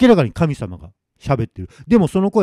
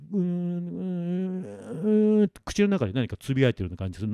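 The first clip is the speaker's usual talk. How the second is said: mumbling under breath; 5 semitones higher, 9 dB quieter than usual. Retold or imitated. Head-bowed god mumbling to himself, indistinct.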